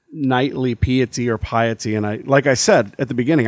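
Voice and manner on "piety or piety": "piety or piety" as PEE-uh-tee, then PIE-uh-tee; first "piety" starts PEE, second starts PIE; both pronunciations. Shown as a demonstration. Unsure reader covers both ways, "piety" as PEE-uh-tee then PIE-uh-tee. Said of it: "I'm gonna say it both ways".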